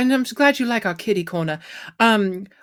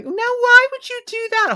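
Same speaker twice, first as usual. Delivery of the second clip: camp satyr; 4 dB louder than usual. Acted. Satyr scolding the robot in his shop, high and camp. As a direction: play a flamboyant seventies satyr, much higher. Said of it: "there is a very camp, very, uh, seventies-esque satyr".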